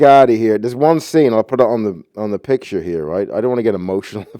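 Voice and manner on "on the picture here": ordinary talk, nothing marked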